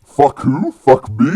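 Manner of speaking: loud, high, indignant mock shout; imitated Polish guard. Polish guard answering the climber's insult, imitated loud indignant shout. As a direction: shout it back as the affronted guard. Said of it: "the Polish security guard goes".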